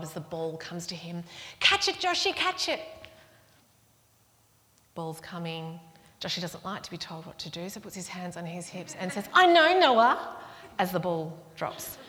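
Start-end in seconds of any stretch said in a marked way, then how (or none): 1.6-2.8 high, loud imitation of a child yelling; urgent child's call. Child calling to a sibling as the ball comes, urgent yell. Child calls during cricket match. 9.3-10.4 loud, high, drawn-out mock retort; indignant child. Child told what to do answers the sibling, indignant.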